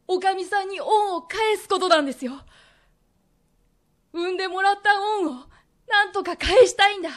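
rising, breaking voice begging for forgiveness; tearful plea. Acted. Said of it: "うつむいて涙をこぼす佐吉". (涙ながらに高い声で懇願する)

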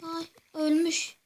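usual speaking voice

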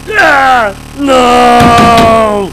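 loud, high despairing screams; real panicked yells at a blue screen; anguished screaming. Real, not acted. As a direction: scream as everything crashes. Computer crashes to blue screen.

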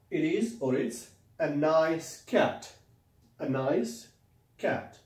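slow teaching demo. Shown as a demonstration. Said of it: "teacher models sentence slowly".

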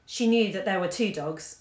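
ordinary delivery, nothing marked

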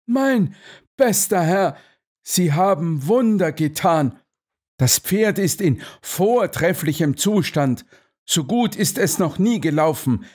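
high, effusive praise; overjoyed amazement. A narrator's reading. Colonel praises Holmes for his wonders, effusive.

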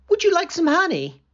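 high, quick and friendly offer; bright eager question, sped up; kind Hannah. Acted. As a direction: Hannah offering the old woman honey, high and fast. Hannah offers honey to woman.

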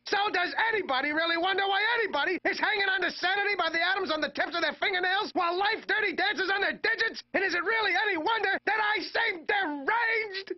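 climactic shouted rant. Acted. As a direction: scream the final question.

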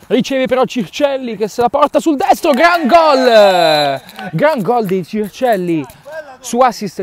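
high, loud goal cry, then drawn out; breathless italian goal commentary. Real, not acted. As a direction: explode on the goal. Goal scored, commentator erupts. (urlo del gol)

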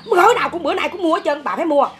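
demanding son. Retold or imitated. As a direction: mimic the son pushing his mother hard.

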